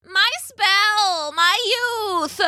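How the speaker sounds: high, anguished witch's wail; despairing high witch voice; witch crying out. A narrator's reading. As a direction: lament it high, as if all is lost. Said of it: "cried the witch".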